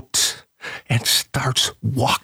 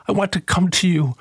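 raised, pleading prayer voice, lifting as it goes; heartfelt prayer; 7 semitones higher than usual. Acted. Preacher voices the congregation's prayer to Jesus, pleading. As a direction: pray it up to the Lord with longing. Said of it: "that should be our prayer".

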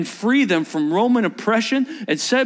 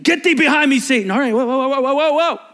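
higher, louder rebuke into startled whoas; mock outburst; 8 semitones higher, 5 dB louder, faster than usual. Jesus rebuking Peter who protests, loud mock outburst. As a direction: burst out, then back off in a string of whoas.

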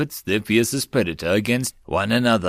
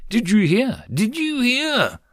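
high, panting panicked cry; panicked mouse; 12 semitones higher than usual. A narrator's reading. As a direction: squeak it out in panic. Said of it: "The mouse cried out".

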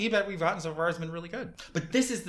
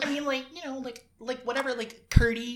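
put-on ditzy girl; 7 semitones higher than usual. Mimics the valley-girl ditz.

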